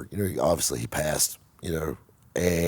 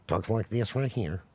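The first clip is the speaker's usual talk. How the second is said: a light northern US accent on these words; 3 semitones higher, 5 dB quieter than usual. Shown as a demonstration. Showing viewers the coworker from up north, in a northern accent. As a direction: show how the guy from up north talks, with a northern accent. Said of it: "keep in mind he's from up north and".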